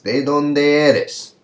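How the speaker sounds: "De dónde eres" slow, high and loud; modelled question. Shown as a demonstration. Teacher recalling a question from the course start, slow modelling. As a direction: stretch "De dónde eres", falling on "eres". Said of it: "when we'd ask".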